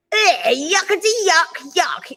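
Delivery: mocking disgust. A narrator's reading. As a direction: pull a yuck face and sneer it. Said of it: "exclaimed the littlest one".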